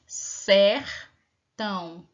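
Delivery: sertão very slow, each syllable held. Shown as a demonstration. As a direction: stretch sertão to its limit. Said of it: "Quantos pedacinhos foram separados?".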